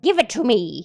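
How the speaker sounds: loud, forceful order; angry demand. Acted. Old man demanding the wand at gunpoint, loud.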